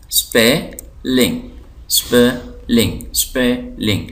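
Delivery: स्पेलिंग split slowly as spe - ling; two syllables apart. Shown as a demonstration. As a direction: repeat स्पेलिंग with a clear gap between spe and ling. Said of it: "इसके अंदर दो syllable हैं".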